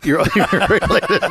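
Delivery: laughing through the words. Real, not acted. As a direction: chuckle as you repeat it. Laughing at guest's joke.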